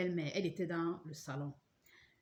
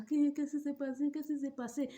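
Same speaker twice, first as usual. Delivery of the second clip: mock-concerned neighbours; 8 semitones higher than usual. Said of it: "ils venaient rire en face".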